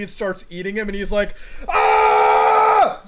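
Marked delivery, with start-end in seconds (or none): 1.7-3.0 long loud "aah" scream; hammed-up, drawn-out scream of terror; mock scream. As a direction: imitate a gamer screaming in panic. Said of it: "like, screams".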